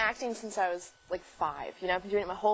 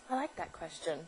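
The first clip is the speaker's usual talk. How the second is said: cool reply; 4 semitones lower, 9 dB quieter than usual. Retold or imitated. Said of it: "she goes".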